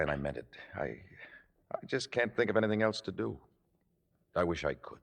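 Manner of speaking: soft, sinking, regretful voice; resigned farewell. Acted. Boyfriend giving up on the relationship, resigned sad farewell. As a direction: let it drop quietly into goodbye. Breaking up, saying goodbye.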